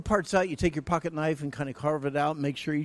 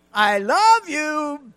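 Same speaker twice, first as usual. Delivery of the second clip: slow, much higher and louder "I love you"; pleading wife; 13 semitones higher, 14 dB louder, much slower than usual. Retold or imitated. Wife repeating "I love you", slow and pleading. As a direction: stretch it out, begging to be heard. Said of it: "wife repeating, drawn out".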